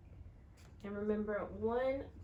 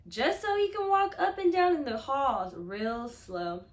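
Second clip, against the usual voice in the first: put-on reading to children; 7 dB louder than usual. A narrator's reading. Teacher reading a picture book to her class, expressive storytelling. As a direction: read it out to the class with feeling.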